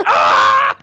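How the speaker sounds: high, loud mock scream of shock; retold yell. Retelling friend sprayed in face, high shocked yell. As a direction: yelp like water just hit your face.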